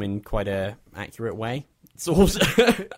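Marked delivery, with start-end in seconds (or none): 2.0-2.9 high, loud wry aside. Real, not acted. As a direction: undercut it with a wry squeak. Wry aside after claim.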